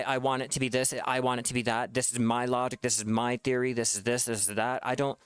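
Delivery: usual speaking voice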